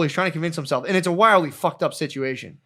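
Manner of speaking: normal talking voice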